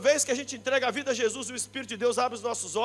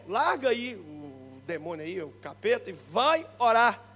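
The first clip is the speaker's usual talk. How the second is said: mock stern dad; 6 semitones lower, 5 dB quieter than usual.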